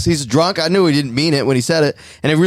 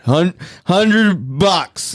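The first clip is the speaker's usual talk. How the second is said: put-on a slow, dragged-out drunk slur of hundred bucks; slurred drunk bet; 5 semitones higher, much slower than usual. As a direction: drawl it out like a hammered guy making a bet. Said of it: "like, that bad".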